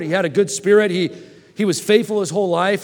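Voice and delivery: ordinary talk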